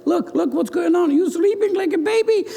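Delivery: high, agitated protest; urgent pleading. Acted. Disciples confront sleeping Jesus in a storm, urgent high protest.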